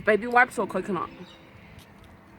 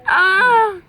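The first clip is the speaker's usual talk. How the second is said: loud high shriek of disgust; 9 semitones higher, 9 dB louder than usual. Real, not acted. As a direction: shriek at the taste. Gross bean reaction.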